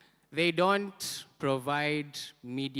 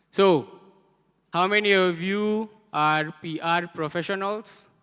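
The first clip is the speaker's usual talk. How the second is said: raised, loud question to the room; loud crowd poll; 5 semitones higher, 8 dB louder than usual. Real, not acted. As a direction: call out to get hands up. Polling crowd, raising hands.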